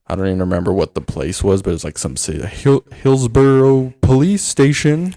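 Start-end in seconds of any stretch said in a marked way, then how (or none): 2.5-5.2 slow, higher and louder, officious answer; lightly voiced police receptionist; mock police desk. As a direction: announce it like a stiff desk officer. Imitating police answering phone.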